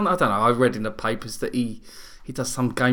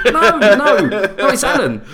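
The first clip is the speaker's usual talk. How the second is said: loud, laughing no no no; laughing correction; 8 semitones higher, 7 dB louder, much slower than usual. Retold or imitated. Dad retelling how he corrected his daughter, loud and laughing. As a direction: correct her while cracking up. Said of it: "dad correcting, laughing".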